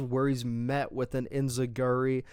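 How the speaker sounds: ordinary delivery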